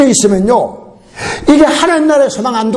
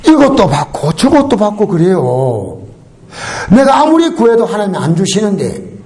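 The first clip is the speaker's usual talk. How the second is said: usual speaking voice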